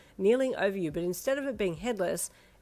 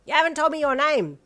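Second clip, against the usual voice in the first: put-on loud boy voice; 8 semitones higher, 10 dB louder than usual. A narrator's reading.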